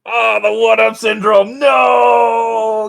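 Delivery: high, loud shout of protest; loud mock-anguished no; dismayed outcry. Real, not acted. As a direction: yell in disbelief at being beaten. GM reacts to being outrolled.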